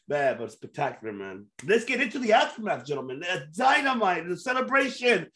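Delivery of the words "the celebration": normal talking voice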